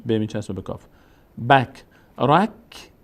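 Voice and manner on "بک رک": بک، رک said very slowly, syllable by syllable. Shown as a demonstration. Teacher sounds out بک، رک syllable by syllable. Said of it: "کاف رو باید یک بار با را بخونیم".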